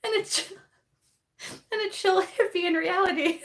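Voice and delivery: high voice breaking into laughter; real laughing fit mid-sentence; cracking up. Real, not acted. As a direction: try to read on through giggles. Cracking up reading a comment.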